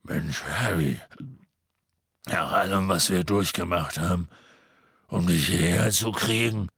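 low gruff voice of a big friend; gruff half-giant pleading. Acted. Hagrid keeps pleading.

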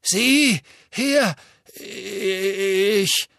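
high, loud, spluttering; flustered minister. A narrator's reading. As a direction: splutter in panic.

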